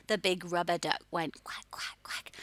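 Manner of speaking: softly whispered rhyme. Shown as a demonstration. Teacher whispers the duck rhyme to the class. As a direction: whisper it like a secret. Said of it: "same rhyme, whispered take".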